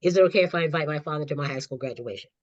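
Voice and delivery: rushed out fast in one breath; nervous teen rushing a question to her mother; very quickly. Retold or imitated. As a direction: blurt it quickly to get it over with. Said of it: "said to my mother very quickly".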